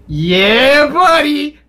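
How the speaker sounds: rising, drawn-out whoop; excited cheer. Real, not acted. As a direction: cheer it upward with delight. Reacting to game trailer news.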